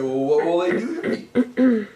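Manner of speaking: a light ghostly voice, nervous. Acted. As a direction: ask it as a wary ghost. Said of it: "And the ghost said".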